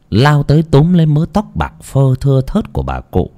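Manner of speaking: ordinary delivery, nothing marked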